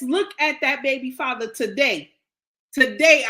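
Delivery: usual speaking voice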